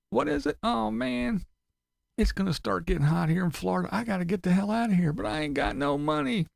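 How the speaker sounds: high whiny mock of Tim. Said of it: "And Tim's doing the same thing".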